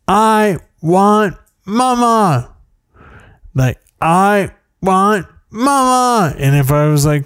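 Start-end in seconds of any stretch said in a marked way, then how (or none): 0.1-2.5 mock child's scream. Dad imitating his daughter's night-time tantrum, mock screaming. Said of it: "She screamed". 4.0-6.3 mock child's scream. Dad imitating his daughter's night-time tantrum, mock screaming. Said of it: "She screamed".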